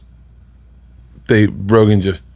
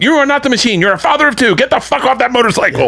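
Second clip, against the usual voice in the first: much higher, shrill mock of his wife; mock scolding wife; 13 semitones higher than usual. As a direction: squeak it like a nagging spouse. Wife voiced scolding him.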